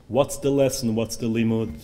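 ordinary delivery

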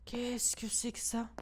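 slow tense whisper. Real, not acted. Streamer spots something in the dark game, whispering. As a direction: hush it, scared of what's there.

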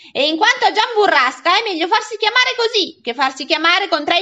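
put-on loud, high boyish tirade; acted cheeky, outraged comeback; indignant retort. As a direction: fire back insults, fed up at last. Boy finally answers back, insulting the man.

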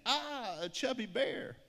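high, drawn-out storybook cry of alarm; mock fright. A narrator's reading. Watchers spot a bear behind them, mock frightened cry. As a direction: cry out in playful, acted alarm.

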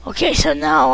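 normal talking voice

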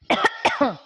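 staged double cough; demonstrated cough. Shown as a demonstration. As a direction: cough on purpose to show the sound. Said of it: "I'm coughing".

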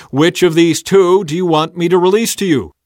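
loud, high address to a crowd. A narrator's reading. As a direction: put the choice loudly to everyone. Governor asks the crowd again.

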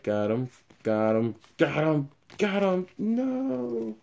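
escalating exasperation, pitch climbing sharply; mounting dismay. Real, not acted. Mock despair at duplicate cards.

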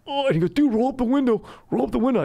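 high-pitched panicked little-brother voice; mock panicked pleading. Imitating embarrassed brother begging to close the car window, high and panicked. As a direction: plead frantically, voice shooting way up.

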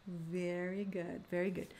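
slow, soft teacher praise. Acted. Teacher praising students after each repeat, soft and slow. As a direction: reassure the learner gently.

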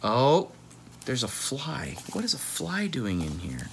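plain reading voice

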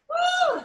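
excited high-pitched whoop. Real, not acted. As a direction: let out a big cheer. Cheering class on.

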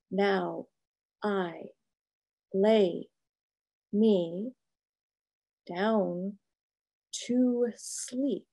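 each word set apart by long pauses; halting word by word. Retold or imitated. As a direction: spell out the prayer one careful word at a time, like someone still learning it. Prayer learned through translation, slowly.

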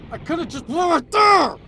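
high, loud burst of self-reproach; annoyed outburst. Real, not acted. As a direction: kick yourself out loud. Missed chance in game.

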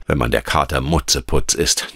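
usual speaking voice

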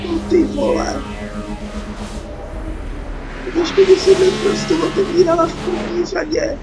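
ordinary talk

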